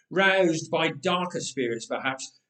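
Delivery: ordinary delivery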